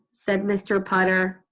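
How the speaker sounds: usual speaking voice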